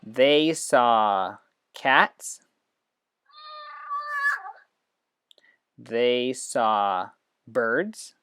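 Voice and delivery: put-on animated read-aloud for young students; storytelling to children. Shown as a demonstration. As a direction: tell it big and slow so the app can follow. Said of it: "on the app tap to get things started".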